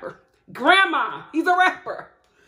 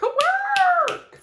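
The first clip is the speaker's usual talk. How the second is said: far higher, drawn-out work; excited high cheer; squealed work; 5 dB louder than usual. Shown as a demonstration.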